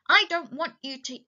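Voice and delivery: high-pitched cross protest; acted annoyance, voice lifted; angry. A narrator's reading. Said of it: "Then Lenny grew angry".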